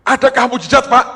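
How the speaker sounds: loud, high-pitched acted question; calling out. Someone asks the man about miracles, calling out loudly.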